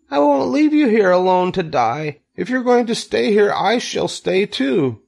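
high, impassioned piglet cry; distraught shout. A narrator's reading. Wilbur refuses to leave Charlotte, distraught shout. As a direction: insist in a high, upset voice. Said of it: "shouted Wilbur".

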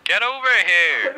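high urgent call; panicking gamer. Real, not acted. Calling teammate during attack.

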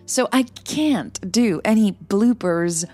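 plain reading voice

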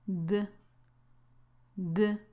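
short voiced D, as at the end of ordered; isolated D sound. Shown as a demonstration. Teacher showing learners the ed sound in ordered, isolated D. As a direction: sound out D alone, briefly and softly. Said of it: "La ed aquí tiene este sonido".

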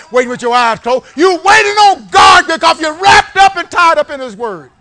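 builds from loud to a high shouting climax; preacher rising to a shout; building preaching. Real, not acted. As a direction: whip the congregation up to a roar. Preaching at full force.

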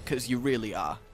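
very low, hushed mutter; muttered aside. Real, not acted. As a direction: grumble quietly at the boss under your breath. Muttered sarcastic address to the boss.

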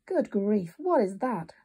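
ordinary delivery, nothing marked

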